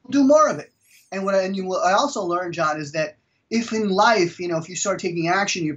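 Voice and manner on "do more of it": emphatic advice. Real, not acted. Punchline advice.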